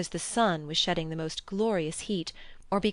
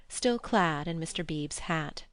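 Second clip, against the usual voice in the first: ordinary delivery, nothing marked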